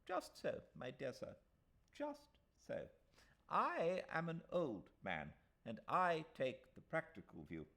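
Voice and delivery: put-on a pompous old solicitor reassuring; old lawyer.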